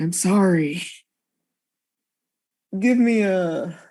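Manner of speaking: ordinary talk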